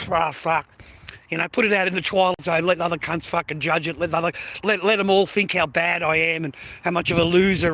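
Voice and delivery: normal talking voice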